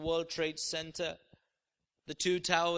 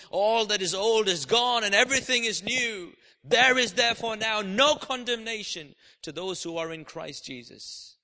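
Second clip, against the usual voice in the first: preaching at full force; 7 dB louder than usual. Real, not acted. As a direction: proclaim it forcefully to the whole church. Declares scripture at full force.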